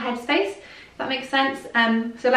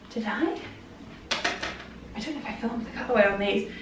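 ordinary delivery

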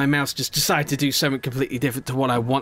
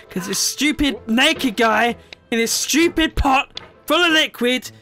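higher, louder mocking tirade; angry rant; 8 semitones higher, 3 dB louder than usual. Real, not acted. Player rants at the naked man in the pot, raised angry voice.

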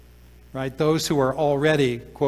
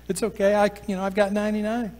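shrugging it off; 6 semitones higher, faster than usual. Retold or imitated. Voicing a shrugging farmer.

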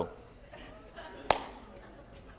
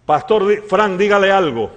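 raised, louder retold urging; urging demand; 6 semitones higher, 11 dB louder than usual. Lady urging the pastor to speak, insistent. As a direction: imitate someone pressing for a response. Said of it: "y me dijo".

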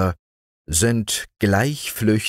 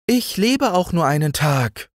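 high, gentle mayfly voice; dreamy mayfly; 11 semitones higher than usual. A narrator's reading. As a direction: say it simply as a carefree mayfly. Mayfly replying.

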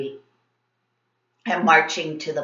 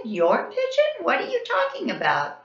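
much higher, incredulous; disbelief; 14 semitones higher than usual. A narrator's reading. Kids baffled by the bully's pet pigeon, incredulous. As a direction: ask it in total disbelief.

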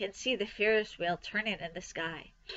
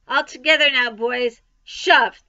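loud, raised call to heave; rallying shout; 3 semitones higher, 10 dB louder than usual. A narrator's reading. Farmer to the men shoving the pig, rallying shout. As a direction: call out a team effort, punching the last word.

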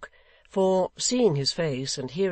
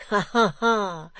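triumphant laugh; 6 semitones higher, 5 dB louder, slower than usual. A narrator's reading.

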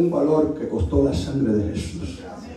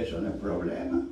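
very low, soft retold reply; understated old woman's assurance; quiet reassurance; 9 semitones lower, 9 dB quieter than usual. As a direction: drop low and quiet, unbothered. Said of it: "Me dice".

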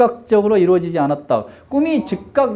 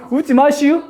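higher, laughing Chungcheong lilt; mock-scold in Chungcheong dialect, half laughing; 7 semitones higher than usual. Shown as a demonstration. Teacher telling laughing students off, in Chungcheong dialect. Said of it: "충청도 버전으로 한번 찍어볼까요".

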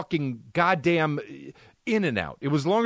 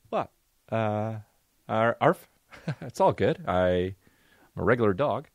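lightly voiced, lower dopey dog talking; dog's inner voice; 5 semitones lower than usual. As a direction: play the dog pretending all is well. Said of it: "and he's just like this".

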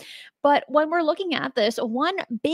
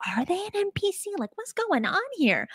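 puzzled inner thought; 9 semitones higher, 6 dB quieter than usual. Retold or imitated. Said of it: "And you think to yourself".

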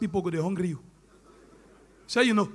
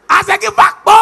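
high, loud, shouted with laughter; shouted punchline; 9 semitones higher, 12 dB louder than usual. Acted. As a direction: shout it to the crowd.

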